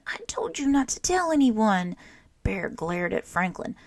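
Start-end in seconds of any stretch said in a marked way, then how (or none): 0.1-2.0 higher, louder reproach; raised dismayed reproach; upset outcry. A narrator's reading. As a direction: cry out in upset blame. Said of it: "cried Franklin".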